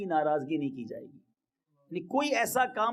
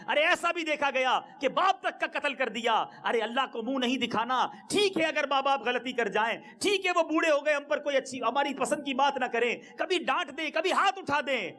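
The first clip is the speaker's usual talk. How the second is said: impassioned preaching; 7 semitones higher than usual. Acted. Impassioned plea about honouring parents.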